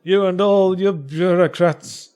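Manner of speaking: slow, sneering character voice. Pretend. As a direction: drag out the complaint in character. Voicing a character's complaint.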